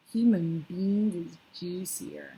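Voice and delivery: slow, heavy brute voice; gruff giant voice. A narrator's reading. Bloodbottler answers the BFG, slow and gruff. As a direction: savour it like a hungry brute. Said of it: "the bottler said".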